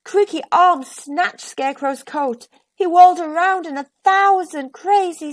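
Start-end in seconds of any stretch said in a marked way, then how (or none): none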